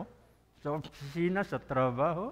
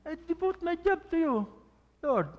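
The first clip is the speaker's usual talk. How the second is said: higher, lightly lifted prayer line; sample prayer; 9 semitones higher than usual. Pretend. Pastor models dedicating work to God, lightly lifted. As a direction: offer it up simply.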